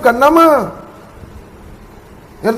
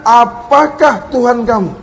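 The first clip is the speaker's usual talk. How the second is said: usual speaking voice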